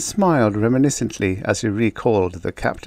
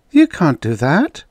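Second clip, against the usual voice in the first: higher, breath-filled shocked objection; acted shock, pitched up; 8 semitones higher, 5 dB louder than usual. A narrator's reading. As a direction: protest as if scandalised. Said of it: "she said in a shocked voice".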